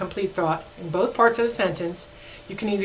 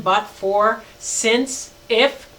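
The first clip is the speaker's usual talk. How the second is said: usual speaking voice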